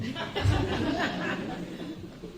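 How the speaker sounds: a high airy chuckle of triumph; high breathy laugh. Real, not acted. Speaker laughs to the audience after the punchline, high airy laugh. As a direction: let out a light high laugh, mostly breath.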